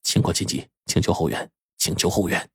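whispered radio call for backup; hushed whisper. A narrator's reading. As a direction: keep it secret, soft and under the breath. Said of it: "小声说".

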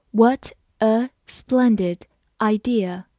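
slow, careful model reading. Shown as a demonstration. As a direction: read it slowly and clearly. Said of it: "好，我们慢慢来读。". (慢速朗读)

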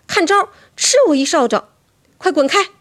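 高而响亮地喝退怪物; 像挥着扫帚赶怪物那样大声喊. A narrator's reading. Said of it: "我也喊道". (高声喝斥)

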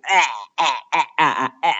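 "哎" as an open short-a "æ", like being chopped in the neck; beheading vowel. Shown as a demonstration. Teacher showing Brian's beheading sound, wide choked "æ". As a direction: open wide and blurt "哎" as a cut-off "æ". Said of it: "想象自己被刀砍了一下脖".

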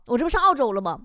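ordinary talk, nothing marked

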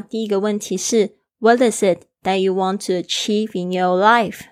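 ordinary delivery

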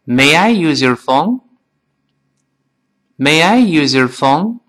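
slow and even, word by word; slow model for repeating; slow reading. Shown as a demonstration. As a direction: read it slowly with the class. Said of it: "跟老师慢慢再读一遍".

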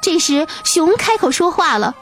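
plain reading voice

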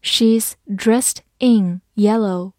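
"She's dressed in yellow" slowly, word by word; slow reading. Shown as a demonstration. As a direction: pause after each word. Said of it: "慢慢来读".

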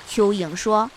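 plain reading voice

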